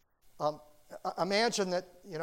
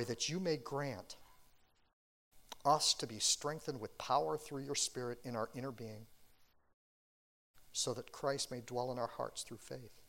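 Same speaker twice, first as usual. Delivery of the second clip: prayer; 3 semitones lower than usual. Acted. Pastor leads closing prayer.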